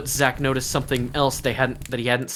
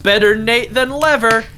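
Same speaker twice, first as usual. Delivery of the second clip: loud shout, higher pitch; 8 semitones higher, 6 dB louder than usual. A narrator's reading. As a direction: yell it out over the noise. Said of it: "Shouting to Sammy".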